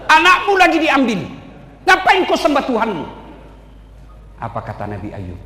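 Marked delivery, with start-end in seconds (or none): 0.1-3.0 the devil yelling at Job to give up; ranting devil. Acted. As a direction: hammer Job with the losses. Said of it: "Apa, setan?".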